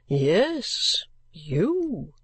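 wrapped-up stranger, slow and gruff; gruff gentleman. Acted. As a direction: growl it at the weeping girl. Gentleman answers.

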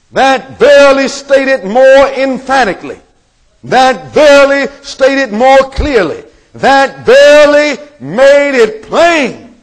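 slow, high, stretched-out declaration; emphatic preaching. Real, not acted. Preacher explaining what verily does, emphatic preaching. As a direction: hammer each phrase slowly from the pulpit.

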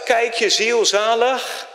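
high, slow pulpit call; stretched-out preacher's cry. Retold or imitated. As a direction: cry it slowly over the congregation. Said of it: "ik riep in de gemeente het uit".

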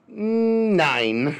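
a hint of German accent on "Nine"; German accent, brief mock refusal. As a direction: say "Nine" like the German nein, lightly mocking. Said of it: "Germany came in and they were like".

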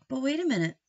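usual speaking voice

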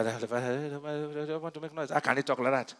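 low, soft mock grumble; imitated grumbling. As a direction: mimic scoffers muttering under their breath. Mimicking scoffers of preachers.

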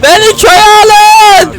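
very high, loud and slow wail; drawn-out mock plea. Gamer wailing again to be let into the village, slow and loud. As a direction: stretch it out like a lament.

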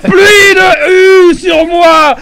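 slow, loud, crying-out shout; anguished yelling. Real, not acted. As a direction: wail it out loud in real despair. Raging at death in game.

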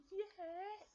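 very high, small cub voice; Baby Bear answering. Acted. Baby Bear answers Mother about chairs, high drawn-out reply. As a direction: draw the word out in a tiny voice.

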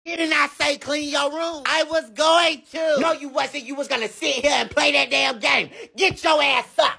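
high, sharp scolding mother; angry mom. Acted. Mother scolding her son over his messy room. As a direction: scold the kid like a fed-up mom.

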